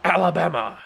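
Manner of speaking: over-the-top stadium commentator call; hyped announcer call. Pretend. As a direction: scream the touchdown like a TV play-by-play man. Announcer voice calling touchdown.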